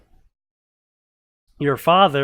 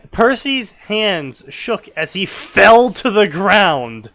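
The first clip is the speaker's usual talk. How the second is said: dramatic build; 6 semitones higher, 8 dB louder, slower than usual. Acted. Dramatic narration of collapse.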